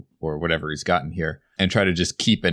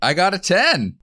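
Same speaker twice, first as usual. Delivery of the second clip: loud, high-pitched triumph; real, elated outburst; excited; 13 semitones higher, 6 dB louder than usual. Real, not acted. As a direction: celebrate a lucky roll at full voice. Player cheers a lucky dice roll.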